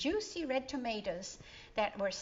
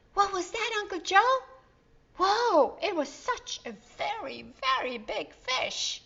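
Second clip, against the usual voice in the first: put-on much higher, louder startled child voices; amazed kids; 10 semitones higher, 7 dB louder than usual. A narrator's reading. Kids amazed at a jumping fish, high and loud. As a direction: gasp it out in wonder.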